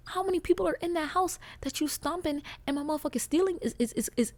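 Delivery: high, rising outraged yell; yelling at neighbor. Acted. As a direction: shout it up at the ceiling in disbelief. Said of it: "Sorry, I just had to yell that".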